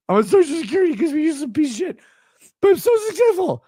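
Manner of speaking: high fast whine; mocking whiny voice. Mocking the fan's replies.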